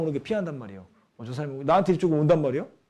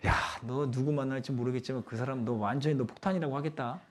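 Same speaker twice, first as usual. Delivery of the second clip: softer mock private jeer; inner-thought aside; 3 semitones lower, 7 dB quieter than usual. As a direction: think the insult aloud, held down. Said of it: "속으로는". (속으로 빈정대듯 낮춰서)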